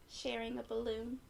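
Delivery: ordinary delivery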